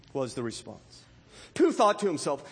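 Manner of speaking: ordinary talk, nothing marked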